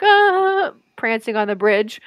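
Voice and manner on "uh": a high, loud, dumbfounded "Uh"; mock new owner. A podcaster mocks the scammed new owner, with a high, loud "Uh". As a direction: play the clueless new bridge owner. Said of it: "You're like".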